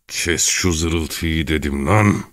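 slow, drawn-out threat; menacing hiss. Acted. Çelkaş ordering Gavrila to stop whining, menacing hiss. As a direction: hiss it slowly to frighten. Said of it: "ürkütücü bir tonla, diye fısıldadı".

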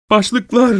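high-pitched strangled outcry; choked, sob-like cry. A narrator's reading. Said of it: "Hıçkırığa benzer boğulur gibi bir sesle".

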